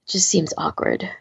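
usual speaking voice

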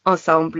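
ensemble with French nasal vowels, "ahn-sahm-bl"; French accent. Shown as a demonstration. Showing how she wants to say the word, French-accented ensemble. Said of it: "with a French accent to it".

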